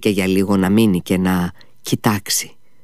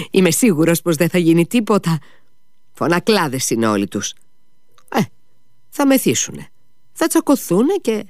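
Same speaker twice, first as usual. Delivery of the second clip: much higher scoffing voice; dismissive mockery; 8 semitones higher than usual. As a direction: wave them off with a sneer. Ο υπάλληλος κοροϊδεύει τους μεθυσμένους. (περιφρονητικά, πολύ ψηλά)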